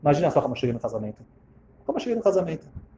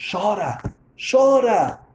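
rising higher with each repeat; urging; 6 semitones higher, much slower than usual. Retold or imitated. Old woman telling stranger to cry, urging. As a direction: urge it on with climbing pitch.

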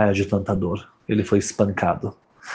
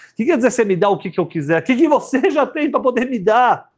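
incredulous; 11 semitones higher than usual. Acted. Incredulous about offer.

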